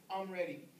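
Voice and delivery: hushed words of a dying man at peace; quiet prayer. Retold or imitated. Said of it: "lift your head up and say".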